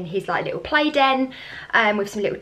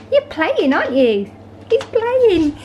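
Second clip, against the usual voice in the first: high sing-song doggy voice; 9 semitones higher, 6 dB louder, slower than usual. Real, not acted. Cooing at the new puppy.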